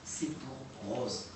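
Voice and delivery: voix lente et plus douce; «C'est pour Rose» dit lentement et doucement, comme la voix de l'enregistrement; imitation de la voix. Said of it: "une personne qui va dire". (imitation lente et douce de la voix entendue)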